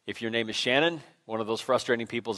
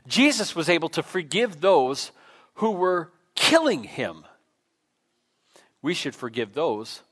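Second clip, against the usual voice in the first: ordinary delivery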